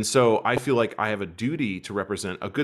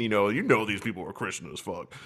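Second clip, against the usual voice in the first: ordinary delivery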